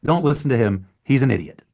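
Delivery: loud, sharp shut up; mock shout. Older actor cutting off his young castmate, loud. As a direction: cut him off loudly. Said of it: "Nick would go".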